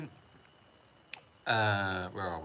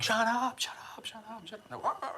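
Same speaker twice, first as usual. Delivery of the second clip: yelling at dogs; 12 semitones higher, 4 dB quieter than usual. Retold or imitated. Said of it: "and I'm like".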